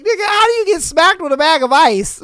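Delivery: loud, high disbelief; can't believe what he read; mock disbelief at the comment; incredulous.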